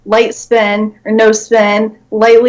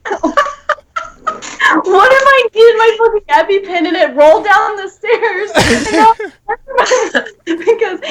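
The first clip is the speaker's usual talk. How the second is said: high laughing complaint, slowed at first; laughing rant; 9 semitones higher than usual. Real, not acted. Complaining to friends about a roommate, laughing all through. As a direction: rant while cracking up.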